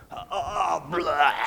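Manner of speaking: cartoonish gulp and blah; noisy, greedy gobbling sound; messy gobbling noise. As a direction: slurp it all down with a blah. Greedy spider gobbles plums noisily.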